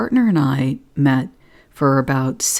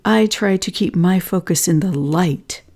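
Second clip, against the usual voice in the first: mock yelling; 6 semitones higher than usual. Project partner refusing to hear more, in a mild mock yell. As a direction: imitate an upset partner's raised, insistent voice. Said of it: "She starts yelling".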